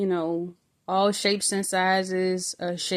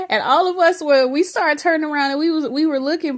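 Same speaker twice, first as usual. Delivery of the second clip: higher and louder, fast and thrilled; excited; 7 semitones higher, 6 dB louder, faster than usual. Real, not acted. Telling viewers how the bus turned around, excited. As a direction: tumble out the words, caught up in it.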